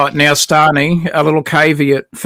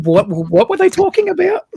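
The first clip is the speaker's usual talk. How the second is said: confused viewer voice; 14 semitones higher than usual. Retold or imitated.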